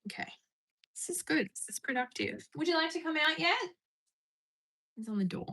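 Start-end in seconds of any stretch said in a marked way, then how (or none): 2.6-3.6 high sing-song question; coaxing pet talk. Real, not acted. Streamer asking the bird to come out, high coaxing.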